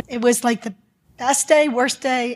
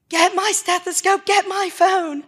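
retold scream; 8 semitones higher than usual. Doctor ordering the children at a crash scene, retold scream. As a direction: imitate calling out urgently, pitched up. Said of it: "I screamed at my children".

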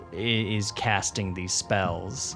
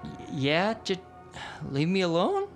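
put-on timid girl voice; 8 semitones higher, 3 dB quieter than usual. GM voicing timid drow girl.

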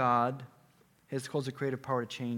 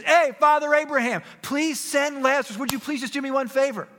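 very high, loud, desperate plea; acted scream; 13 semitones higher, 11 dB louder than usual. Rich man in hell calling to Father Abraham, screamed plea. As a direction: cry out for mercy across a great gulf. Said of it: "he screams, he says".

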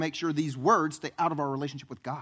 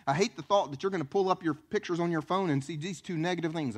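usual speaking voice

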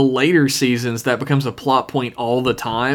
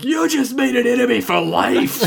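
put-on high, indignant comic Scottish accent; Willie; 10 semitones higher than usual. Willie retorting to Principal Skinner, mock Scottish indignation. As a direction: declare a feud as a hammed-up Scotsman. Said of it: "And he's like".

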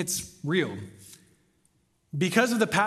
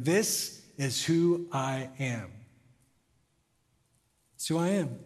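solemn declaration; 4 semitones lower, 3 dB quieter, slower than usual. Acted. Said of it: "He's saying".

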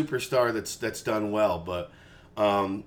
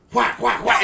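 bat crack noises, voiced high and punchy; imitated bat cracks; 9 semitones higher, 3 dB louder than usual. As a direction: sound out each crisp hit. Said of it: "he would just go".

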